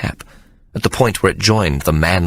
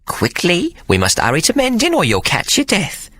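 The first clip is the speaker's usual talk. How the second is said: high, fussing nanny voice; solicitous nanny; 11 semitones higher than usual. A narrator's reading. Hannah hurrying Sir Jasper to mend, solicitous. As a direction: fuss over him like a nanny. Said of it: "Hannah said solicitously".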